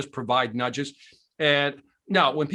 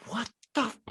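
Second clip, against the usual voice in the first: high, incredulous disbelief; can't believe it's happening; stunned disbelief; 7 semitones higher than usual. Retold or imitated. Stunned that his idol cares.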